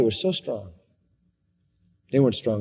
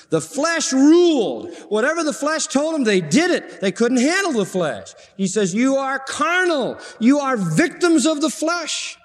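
full-force preaching; 12 semitones higher than usual. Acted. Preacher telling the congregation what Paul charged, full-force preaching. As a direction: preach it high and emphatic, stretching key words.

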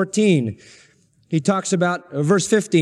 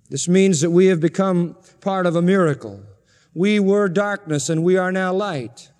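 normal talking voice

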